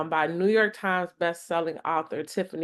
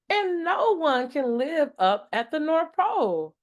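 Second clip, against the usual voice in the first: high, teasing schoolgirl voice; mocking kid; 10 semitones higher than usual. Carmen mocking Deja's Santa belief, high and teasing. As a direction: pitch up and tease.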